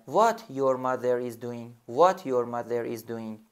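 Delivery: slow, carefully spaced What your mother is doing; slow teacher dictation; slow model sentence. Shown as a demonstration. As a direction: dictate word by word. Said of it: "what رو میاریم اول".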